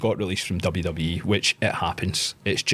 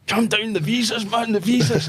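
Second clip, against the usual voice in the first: calming mate; 14 semitones higher, 5 dB louder than usual. Retold or imitated. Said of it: "Mark was like".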